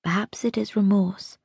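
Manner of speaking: ordinary delivery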